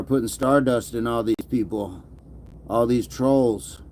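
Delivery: usual speaking voice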